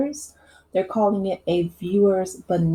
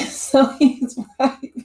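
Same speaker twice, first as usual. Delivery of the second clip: higher, slow, laughing through the words; 6 semitones higher, slower than usual. Real, not acted. Host enjoying the comedian's comeback, laughing. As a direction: break up laughing as you say it.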